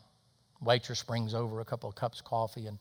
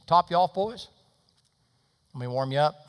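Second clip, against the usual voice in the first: lightly acted waitress, higher and louder at first; chirpy waitress; 5 semitones higher, 7 dB louder than usual. Waitress offers the men more coffee, chirpy. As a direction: call out the refill offer.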